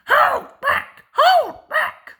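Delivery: very high, loud frantic cry. A narrator's reading. Mavis crying out as the trucks push her. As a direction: scream it in panic. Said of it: "they cried".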